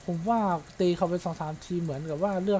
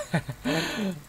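nervous laugh; 5 semitones higher than usual. Real, not acted. Laughing at his own blunder.

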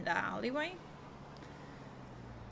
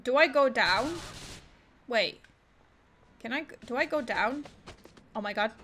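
ordinary talk, nothing marked

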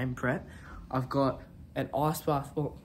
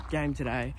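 usual speaking voice

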